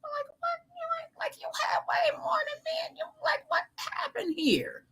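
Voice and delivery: very high, breathy whispered disbelief; disbelieving whisper. Retold or imitated. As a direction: gasp it out in stunned disbelief, barely voiced. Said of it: "And it's like".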